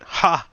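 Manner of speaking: a short loud high bark of a laugh; one scornful burst of laughter; sharp mock laugh. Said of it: "I literally just went".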